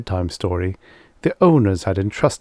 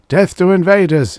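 high, loud war cry; crazed shout, pitched way up; yelled battle cry; 10 semitones higher, 7 dB louder than usual. A narrator's reading. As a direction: yell it like a charging soldier. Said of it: "he yelled".

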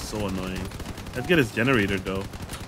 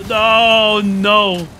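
dismayed no; 8 semitones higher, 6 dB louder than usual. Real, not acted. Losing parts in battle.

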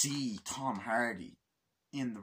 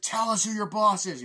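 much higher and louder demand; hammed-up agent shout; mock threat; 10 semitones higher, 9 dB louder than usual. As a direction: yell at the prisoner. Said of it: "he's like".

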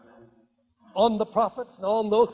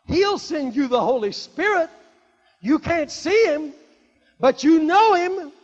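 loud, raised, emphatic scripture proclamation; full-force preaching; 5 semitones higher, 8 dB louder than usual. Acted. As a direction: proclaim the verse to the whole congregation.

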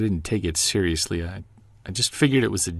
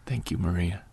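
low, soft, grateful; soft thanks; 6 semitones lower, 8 dB quieter than usual. Acted. Husband thanking his wife for staying, tender and soft.

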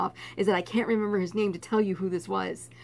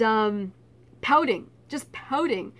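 ordinary talk

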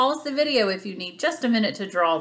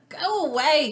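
high, annoyed brush-off; mild snap; 8 semitones higher than usual. Acted. Tickled Crab sends Octopus off, mildly snapped. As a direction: snap it lightly, pitched up. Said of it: "he snapped".

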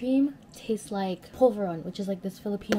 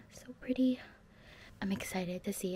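whispering; 6 dB quieter than usual. Real, not acted. Whispered asides.

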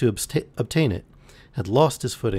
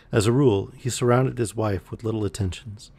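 ordinary delivery, nothing marked

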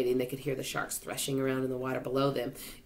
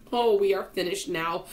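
raised, louder cry of despair; distressed, higher voice; 5 semitones higher, 5 dB louder than usual. A narrator's reading. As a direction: cry out that all is lost. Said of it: "cried Miss Spider".

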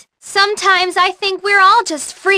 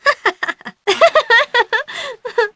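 flirty laugh; 7 semitones higher than usual. Acted.

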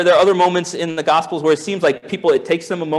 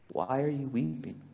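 quiet, tender asking; soft reverent question; 3 semitones lower, 14 dB quieter than usual. Acted. Pastor savouring Jesus' words, soft and reverent. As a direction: murmur it with awe.

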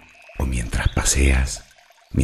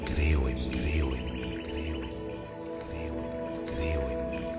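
much softer echo, receding into silence; fading echo; 12 dB quieter than usual. Acted. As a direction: repeat the affirmation like a distant, fading inner voice. Affirmation repeated as fading echo. (eco suave que se desvanece)

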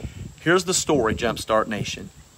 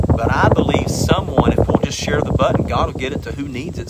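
loud, urgent preacher's call; preaching at full force; 2 semitones higher, 6 dB louder than usual. Acted. Preacher urging viewers to speak the word, loud and fervent. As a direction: drive it home to the congregation.

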